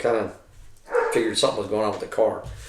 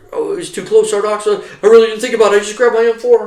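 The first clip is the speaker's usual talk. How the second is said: excited explanation; 9 semitones higher, 7 dB louder than usual. Retold or imitated.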